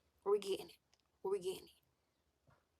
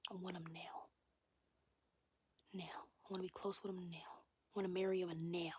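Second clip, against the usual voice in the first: whispered confession of longing; 6 dB quieter than usual. Real, not acted. Vlogger confessing she wants him now, whispered. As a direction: confess it in a hush. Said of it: "whispered confession".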